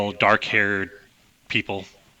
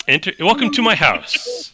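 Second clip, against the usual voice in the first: put-on light Russian-accented innkeeper; a hint of a thick Russian accent; 9 semitones higher, 5 dB louder than usual. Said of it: "a thick Russian accent".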